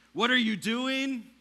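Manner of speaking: high, loud cry of desperation; a pleading yell to a silent God; anguished shout. Retold or imitated. Crying out to God in storm.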